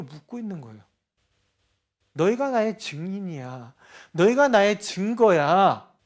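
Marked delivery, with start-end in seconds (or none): 4.1-5.9 slow emphatic declaration. Acted. Preacher repeats with emphasis.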